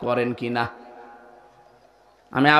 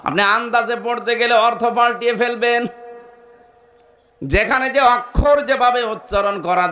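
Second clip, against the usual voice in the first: preaching shout; 8 semitones higher than usual. Real, not acted. Preacher warning the crowd against guessing, in a high shout. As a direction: cry the warning out over the crowd.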